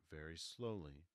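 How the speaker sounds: usual speaking voice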